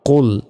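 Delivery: "কুল" alone, emphatic and thick as "qol"; firm full-mouthed "qol"; heavy qol. Shown as a demonstration. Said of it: "কুল মোটাভাবে উচ্চারণ হবে".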